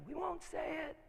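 high, soft, eerie demon voice; demonic voices. Retold or imitated. Possessed guy answering in thirty voices, eerie and high. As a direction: imitate possessed voices, pitched high and hushed. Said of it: "thirty voices laid over each other".

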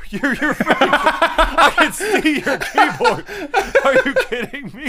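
high, loud, laughing protest; incredulous, drawn out through laughter; laughing disbelief. Real, not acted. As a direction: crack up while scolding a friend. Laughing disbelief at friend's laziness.

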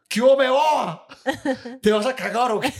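loud, high mock outburst at the wife; hammed-up indignant yell; mock angry dad. Dad yelling at mom over her fart, mock angry.

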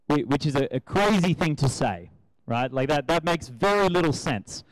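ordinary delivery

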